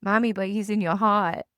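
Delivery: a hint of a little boy struggling with his R's; gentle mimic of a young kid; little son's voice. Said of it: "R's are hard right now".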